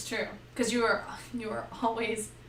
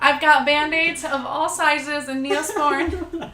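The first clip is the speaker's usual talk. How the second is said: louder, higher and slow, fussing; fussy healer; 4 semitones higher, 8 dB louder, slower than usual. Retold or imitated. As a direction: offer supplies like an eager medic. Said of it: "I'm like".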